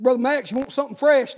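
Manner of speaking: bright, loud call to the sick man; a loud, cheery hello to a sick friend; loud cheery greeting. Retold or imitated. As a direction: hail him loudly and cheerfully. Preacher greeting dying man brightly.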